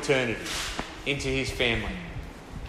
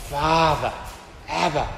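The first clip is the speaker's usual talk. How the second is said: heartfelt cry. Shown as a demonstration. Preacher voicing the Spirit's cry to the Father, heartfelt. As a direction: cry out with real emotion. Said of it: "cries out in the depths of our being".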